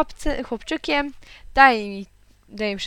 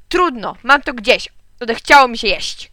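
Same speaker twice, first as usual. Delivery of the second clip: loud, fed-up outburst; angry shout; 2 semitones higher, 8 dB louder than usual. A narrator's reading. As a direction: shout that you've had enough. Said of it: "krzyknął tata". (głośny, rozdrażniony wybuch)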